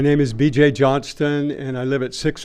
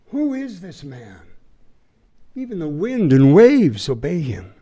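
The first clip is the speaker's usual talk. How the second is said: mild storyteller's awe; 2 semitones higher, 4 dB louder than usual. A narrator's reading. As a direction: ask it in hushed, fearful wonder. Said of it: "the disciples were absolutely terrified".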